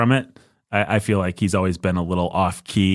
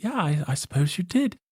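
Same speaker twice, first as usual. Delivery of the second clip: high, softer put-on woman's voice; newscaster conceding; 10 semitones higher, 4 dB quieter than usual. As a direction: concede grudgingly in a lady's voice. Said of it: "she's like".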